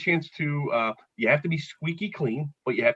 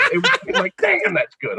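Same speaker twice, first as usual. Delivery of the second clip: laughing; 5 dB louder than usual. Retold or imitated. Delighted at own punchline.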